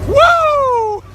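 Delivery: high joyful whoop; excited whoop. Real, not acted. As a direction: let out a big happy woo. Excited cheer on tractor.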